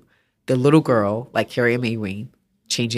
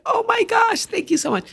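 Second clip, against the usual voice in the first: much higher, gushing gratitude; overjoyed thanks. Retold or imitated. The woman thanks the artist, high overjoyed gush. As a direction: squeal of thanks. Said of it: "She's like".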